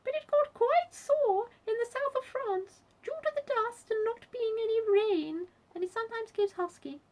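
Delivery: loud, very high-pitched squeak; squeaky Duchess. A narrator's reading. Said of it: "squeaked the Duchess".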